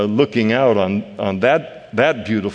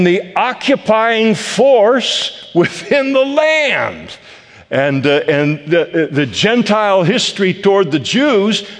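higher, louder climbing preacher's cry; preaching at full force; 7 semitones higher, 4 dB louder than usual. Acted. Pastor stirs his congregation about Gentiles: rising preaching fervour. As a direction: build the fervour line by line.

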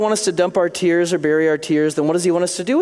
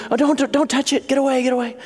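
put-on protective kid; 8 semitones higher, faster than usual. Child guarding its seed.

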